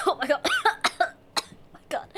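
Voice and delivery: ordinary delivery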